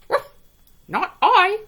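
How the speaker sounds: high yappy barks then a loud refusal; a little dog saying no. A narrator's reading. Dog refusing the hen, loud yappy. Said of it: "said the dog".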